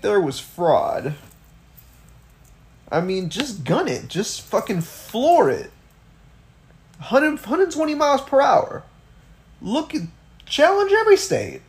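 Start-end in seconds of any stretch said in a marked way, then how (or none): 2.9-11.6 climbing higher into a strained rant; exasperated urging. Real, not acted. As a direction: egg them on, higher and higher. Mock exasperated rant, urging them on.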